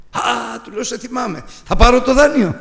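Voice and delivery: high, excited burst ending in a laugh; suddenly thrilled, with a laugh; joyful cry of relief; overjoyed recognition. Retold or imitated.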